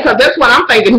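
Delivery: normal talking voice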